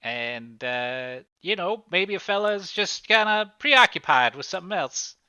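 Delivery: lightly voiced, halting witness with high, loud bursts; evasive witness. Cornered witness excuses his nights out, haltingly evasive. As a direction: stall and fumble toward an excuse.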